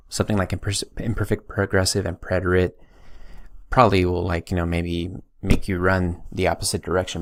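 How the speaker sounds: ordinary talk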